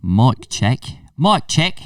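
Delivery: mock Scouse mic check; put-on Liverpool accent. Podcaster playing a visiting Liverpudlian at mic check, Liverpool accent. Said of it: "We could talk like this".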